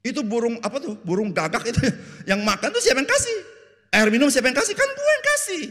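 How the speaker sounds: high, loud exasperated retort; indignant God. Acted. Preacher voices God answering the complaining Israelites, high and exasperated. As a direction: rattle off the gifts in disbelief. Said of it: "Tuhan bilang".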